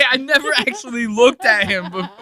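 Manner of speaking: high, loud shocked outburst. Real, not acted. Streamer seeing the guest's face, in loud laughing shock. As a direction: blurt it out in disbelief, laughing.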